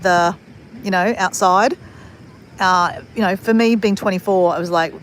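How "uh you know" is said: ordinary talk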